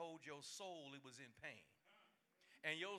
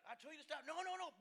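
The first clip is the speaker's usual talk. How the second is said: higher, rising imitation of mama and kid; mock mother then child; 10 semitones higher than usual. Preacher reenacting a mama warning her kid, mock scolding.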